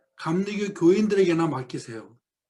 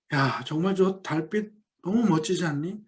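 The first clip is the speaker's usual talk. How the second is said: normal talking voice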